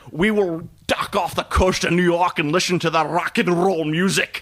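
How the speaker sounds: hammed-up Scottish submarine captain; Sean Connery. Podcaster quoting the film's captain, Scottish-accented impression. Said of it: "Sean Connery playing a Russian captain with a Scottish accent".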